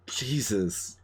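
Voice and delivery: ordinary talk, nothing marked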